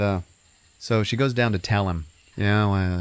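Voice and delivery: usual speaking voice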